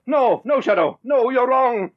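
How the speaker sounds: frightened denial. Acted. Kendall denies in panic.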